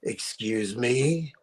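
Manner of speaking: very low, slow celebrity impression; Harvey Fierstein. Star to waiter he thinks is mocking him, low slow impression. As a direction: drag it out low, as if affronted. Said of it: "you do a very good".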